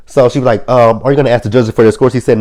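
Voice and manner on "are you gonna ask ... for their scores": fast, louder, higher haughty question; rushed diva demand; mock haughty demand. Elektra's demand, retold.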